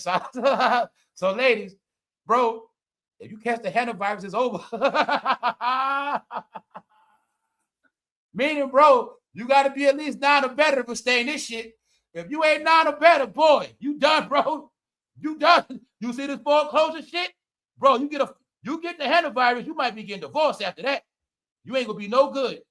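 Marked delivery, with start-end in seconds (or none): 4.7-6.8 laughing. Real, not acted. Long laugh at the news. 8.3-18.2 loud rant. Real, not acted. Ranting loudly to viewers.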